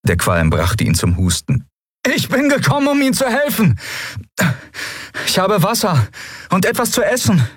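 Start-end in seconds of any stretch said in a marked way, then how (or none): 2.1-7.5 higher, coaxing voice calling into ruins; pleading young man. Acted. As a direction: gently coax someone out of hiding. Kai offers help.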